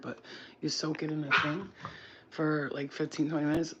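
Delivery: normal talking voice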